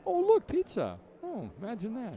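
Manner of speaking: high-pitched mock discovery, all innocence; feigned surprise. Strolling over to the boys' pizza, feigned surprise.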